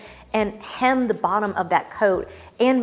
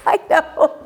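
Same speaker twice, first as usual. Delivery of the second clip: laughing; 11 semitones higher than usual. Real, not acted. Laughing at own outfit.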